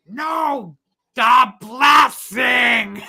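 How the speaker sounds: a drawn-out corrective shout; mock shout. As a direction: bellow it at the deaf aunt. Uncle [name] corrects Bethany.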